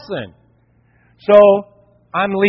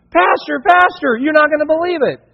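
put-on high, eager children calling out; excited kids; 9 semitones higher than usual. Neighborhood kids rush to the pastor, high and excited. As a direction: call out like thrilled kids. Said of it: "kids from the whole neighborhood".

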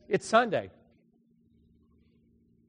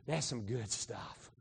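low, slow, quiet satisfied voice; God pleased; 7 semitones lower, 14 dB quieter, slower than usual. Retold or imitated. God looking back on creation, low and quiet satisfaction. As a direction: savor it softly, like admiring finished work. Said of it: "he looked and he says".

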